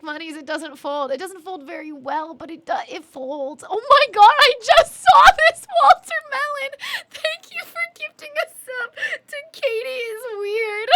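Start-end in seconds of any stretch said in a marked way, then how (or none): 3.7-10.9 high, loud tearful exclamation; overwhelmed, near tears. Real, not acted. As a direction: gush thanks in a high voice on the edge of crying. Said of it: "also the definitely not tears".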